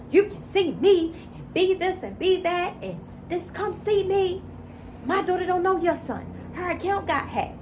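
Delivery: high, put-on mocking voice of the other mother. Mother on the phone, mimicked in a high mocking voice.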